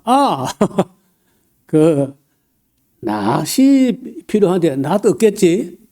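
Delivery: slow, roundabout Chungcheong drawl with a laugh. Retold or imitated. Borrowing a sickle from a neighbor, in a slow Chungcheong drawl. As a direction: lightly imitate a Chungcheong neighbor hinting at a sickle. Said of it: "질질 빼는 게 이제 충청도 스타일".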